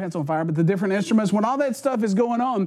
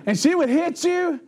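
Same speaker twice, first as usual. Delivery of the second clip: ordinary talk, nothing marked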